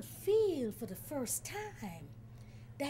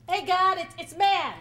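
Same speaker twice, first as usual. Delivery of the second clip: calling out; 3 semitones higher, 11 dB louder than usual. Acted. Man calling at door.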